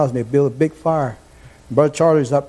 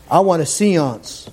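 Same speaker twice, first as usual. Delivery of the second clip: ordinary delivery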